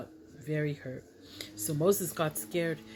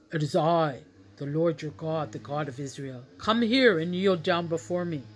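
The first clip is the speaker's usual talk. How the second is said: commanding divine voice, loud bursts; voice of God; 4 dB louder than usual. Acted. God calls Moses from the burning bush, commanding divine voice. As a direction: call out with God's authority. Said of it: "he heard a voice".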